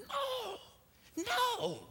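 put-on high, pleading little-boy voice; frightened boy. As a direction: play the scared son begging his dad. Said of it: "And Alexander McLaren's going".

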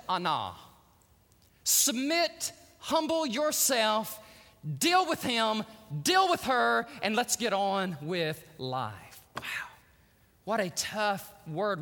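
ordinary delivery

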